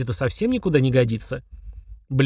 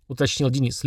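ordinary delivery

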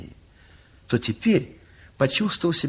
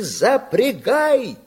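drawn-out, raised call of "Запрягай!"; mock shout; 6 semitones higher, much slower than usual. Pharmacist orders Petka to harness the horse, drawn-out shout. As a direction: call out the order slowly and urgently. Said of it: "крикнул Петьке".

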